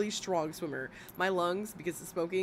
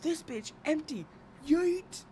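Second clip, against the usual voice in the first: meme yeet voice; 8 semitones higher, slower than usual. Retold or imitated. Streamer mimicking the meme while laughing at chat, put-on yeet voice. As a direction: toss it away with a big yeet. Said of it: "It's the".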